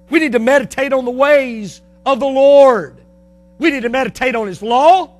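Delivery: loud, high, hammering repetition; full-force preaching. Acted. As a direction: drive each point home from the pulpit.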